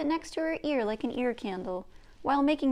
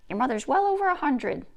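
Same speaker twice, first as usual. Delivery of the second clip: louder grandmother cutting in; an old woman stating it plainly; Nokomis; 3 semitones higher, 6 dB louder than usual. Acted. Grandmother chimes in.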